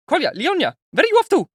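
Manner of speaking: high, loud frightened call; panicked mother calling her children, very high; scared exclaim. A narrator's reading. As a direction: call after the running children in alarm. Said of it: "exclaimed in a scared voice".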